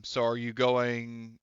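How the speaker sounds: normal talking voice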